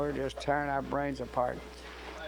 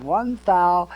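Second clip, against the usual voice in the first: louder, higher retold reply; raised to be heard; 4 semitones higher, 9 dB louder than usual. Bandleader answering over his shoulder, retold in a raised voice. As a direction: toss it back loud enough to carry. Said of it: "so I could hear him".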